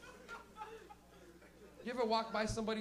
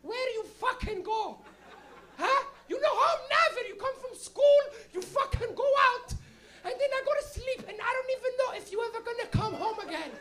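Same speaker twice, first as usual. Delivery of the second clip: high Portuguese mom voice building to a loud rant; nag, then blow up as a Portuguese mother; hammed-up Portuguese-accented scolding, getting louder and higher; Portuguese parent scolding; 8 dB louder than usual. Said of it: "have your parents ask you that".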